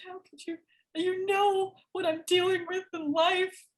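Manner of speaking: high, slow, wailing mock heartbreak; fake-sobbing friend wailing at a friend over a slur; melodramatic betrayal. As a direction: ham up the hurt like a soap opera. Mock betrayal, retold scene.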